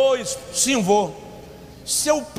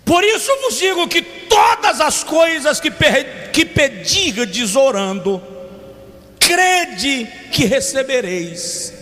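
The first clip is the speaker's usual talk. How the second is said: preaching at full force; 4 semitones higher, much slower than usual. Acted. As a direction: proclaim the verse, stretching every word. Reads scripture at full force.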